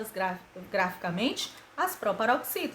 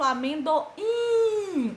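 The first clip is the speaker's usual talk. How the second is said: "amendoim" with a long, high held IM; stressed syllable held; 5 semitones higher, much slower than usual. Shown as a demonstration. Teacher giving an example of tonic syllable, IM drawn out in "amendoim". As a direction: stretch out the final "im" of "amendoim". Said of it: "O im tem que ficar lá na sílaba tônica".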